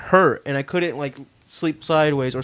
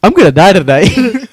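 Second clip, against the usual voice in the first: high, very loud exclamation of doom; retold comic panic; 5 semitones higher, 14 dB louder than usual. Caught kid dreading his family that night, comic panic. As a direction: blurt it loudly, hamming up the dread.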